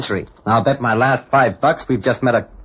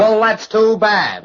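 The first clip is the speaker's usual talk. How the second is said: defiant sneer; 9 semitones higher, 4 dB louder, much slower than usual. Acted. Defies demand for apology.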